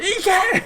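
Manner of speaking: high, loud, comic outcry; fighter's cry. Acted. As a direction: yelp it up high. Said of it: "He's like".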